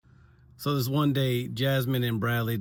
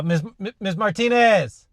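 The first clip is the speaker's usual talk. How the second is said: calling out; 9 semitones higher, 7 dB louder, slower than usual. Real, not acted. Calling out to colleague.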